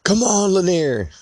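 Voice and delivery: high call that falls on the name; retold scream. Father calling his other kid over his left shoulder, high mock yell. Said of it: "Screamed over my left shoulder".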